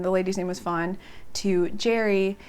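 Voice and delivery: normal talking voice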